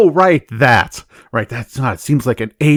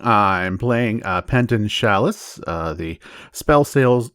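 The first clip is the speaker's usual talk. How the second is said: ordinary delivery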